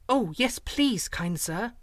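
put-on high-pitched, eager little girl. A narrator's reading. Slave girl begs to hear his story, eager. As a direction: plead keenly for the story. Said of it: "she replied eagerly".

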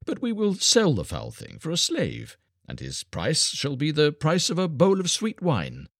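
high-pitched, amused jeer; laughing cry. A narrator's reading. Armoured man offers the beggar boy as a slave, amused jeer. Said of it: "he cried, laughing".

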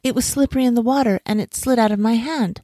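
high, apologetic lament easing down; sigh, then explain apologetically; mildly rueful woman; sighing regret. A narrator's reading. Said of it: "the woman sighed and said".

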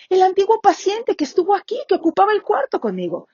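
high, indignant complaint; indignant accusation. Acted. Patient accusing the nurse of deceiving him, high and indignant. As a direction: accuse her of tricking you.

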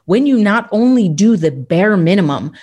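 ordinary delivery

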